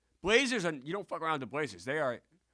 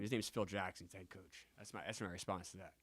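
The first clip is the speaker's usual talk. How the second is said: ordinary delivery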